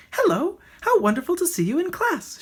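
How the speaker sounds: high, chirpy bird voice; chirpy greeting. A narrator's reading. The bird greeting Rocket in class, high and chirpy. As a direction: greet him like a cheery songbird. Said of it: "she chirped".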